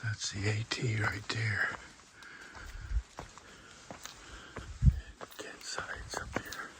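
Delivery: hushed whisper so others sleep. Real, not acted. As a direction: whisper softly, campers asleep nearby. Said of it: "I'm whispering 'cause a lot of people are sleeping".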